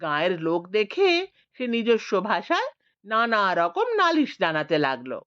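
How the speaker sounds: ordinary delivery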